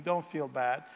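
normal talking voice

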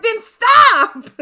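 high, loud exasperated yell; exasperated shout. Retold or imitated. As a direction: shout at the character to stop. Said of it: "And I'm like".

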